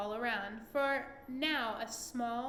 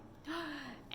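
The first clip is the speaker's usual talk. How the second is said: soft gasp; 5 semitones higher, 6 dB quieter than usual. Real, not acted. Reacting to picture with child.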